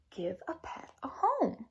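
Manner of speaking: slow whispered sign reading; hushed reading. Acted. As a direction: whisper it like a secret discovery.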